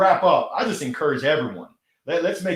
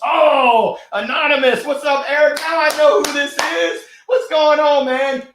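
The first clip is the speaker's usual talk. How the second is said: high, loud delighted hello to a friend; light up and call out; excited greeting; 11 semitones higher, 7 dB louder than usual. Real, not acted. Recognizes friend Eric, excited greeting.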